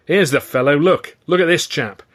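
high, taunting stage address; mocking showman. A narrator's reading. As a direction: point out a rival for public ridicule. Ed mocks Stan to audience.

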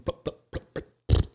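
breathy engine-dying plop plop; spluttering engine noise. Retold or imitated. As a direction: mimic a car sputtering out.

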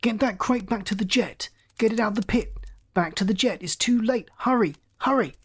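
high, urgent string of orders; frantic shout. A narrator's reading. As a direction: panic into the radio, volume held back. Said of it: "Scott shouted frantically into his radio".

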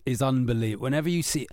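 usual speaking voice

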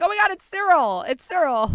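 sudden high excited gasp of recognition. Retold or imitated. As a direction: blurt it out, thrilled to recognize him. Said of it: "I was like".

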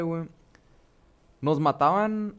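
ordinary talk, nothing marked

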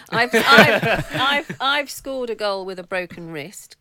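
laughing boast. Real, not acted. Boasting, laughing at own story.

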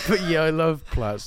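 slow mocking echo; parroting mimic. Co-host parroting her line back slowly to tease. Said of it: "co-host parrots it".

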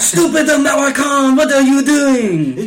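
mocking a French driver, loud and hammy; mock French accent. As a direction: play a panicked Frenchman yelling at the traffic. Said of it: "There it is".

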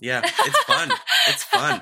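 laughing high and loud. Real, not acted. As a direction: say it through a laugh. Laughing reply.

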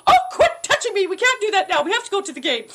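high-pitched mock scolding; improvised stage wife. Actress improvising with stage husband, high playful scolding. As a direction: shoo a husband off, hammed-up and high. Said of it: "then I go".

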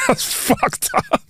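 squeaky high laugh through the words; giggling at how outrageous the waiter's remark is; laughing disbelief. Real, not acted. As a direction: laugh it out, half breathless.

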